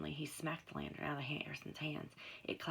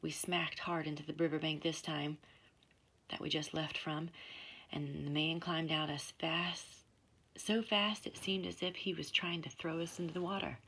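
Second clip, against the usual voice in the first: ordinary delivery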